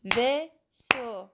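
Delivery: Vaisseau softly split into two clapped beats, vais-seau; clapping syllables. Shown as a demonstration. Teacher clapping out Vaisseau so pupils can listen.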